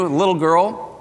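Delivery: ordinary talk, nothing marked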